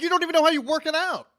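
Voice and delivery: high, rushed, exasperated squawk; mock disbelief. Ranting to co-host about clueless gym posters, high mock disbelief. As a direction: push it up high and fast, mock-incredulous.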